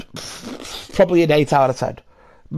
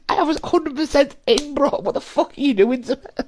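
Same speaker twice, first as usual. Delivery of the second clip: exasperated protest; 9 semitones higher than usual. Retold or imitated. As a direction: plead with the mate who spoiled it. Same protest continued.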